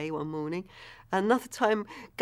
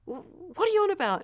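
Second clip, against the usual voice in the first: higher, quick, baffled irritation; annoyed retold policeman; 12 semitones higher, 3 dB louder, faster than usual. First policeman to his excited colleague, annoyed. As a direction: imitate an exasperated colleague, louder and faster. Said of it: "this first policeman was getting really annoyed".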